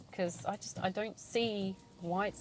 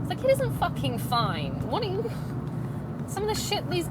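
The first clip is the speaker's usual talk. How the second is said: angry; 10 semitones higher than usual. Real, not acted. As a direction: hammer each word, furious. Angry rebuttal of agency.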